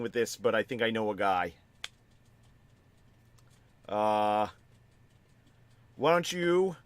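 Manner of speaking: put-on a light Bronx-style accent on the armorer; a hint of a gruff Bronx shopkeeper accent; armorer's accent. Armorer offering the player a deal, light Bronx accent. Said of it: "He says in his accent".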